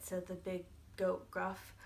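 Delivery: usual speaking voice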